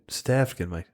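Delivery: half-hearted South African accent, tailing off; South African accent again. Shown as a demonstration. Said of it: "I'm pretty sure that's what they say".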